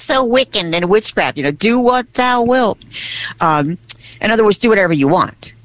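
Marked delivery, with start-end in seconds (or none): 1.5-2.8 slow, higher-pitched "Do what thou wilt"; drawn-out, raised-pitch recital; mock-solemn quote. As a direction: intone it slowly like an occult law. Mock-solemn quote of occult law.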